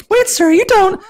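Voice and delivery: squeaky falsetto for the lady; high lady voice. Acted. Narrator voicing the lady in a high falsetto. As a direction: pitch way up into a girlish falsetto.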